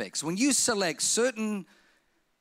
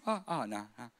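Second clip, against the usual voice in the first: sheepish retreat; 10 semitones lower, 9 dB quieter than usual. Retold or imitated. Backing down from the claim.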